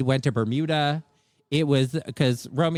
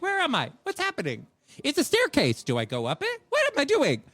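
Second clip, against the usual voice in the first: put-on high, swooping confused-kid voice; mock bewildered kid; 9 semitones higher than usual. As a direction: mimic a lost little kid, pitch jumping up. Mimics lost, dithering little kids.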